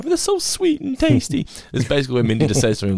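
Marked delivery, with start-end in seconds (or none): 0.0-1.5 much higher, drawn-out whiny Homer voice, slower; Homer impression. Host quoting Homer's dessert line in a whiny Homer voice. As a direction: stretch it out high and pleading.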